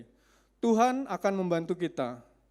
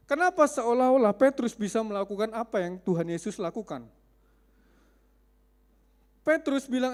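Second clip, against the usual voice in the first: usual speaking voice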